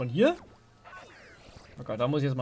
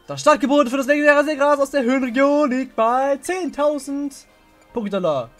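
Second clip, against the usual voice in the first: auctioneer; 7 semitones higher, 4 dB louder than usual. Acted. Auction opening bid called.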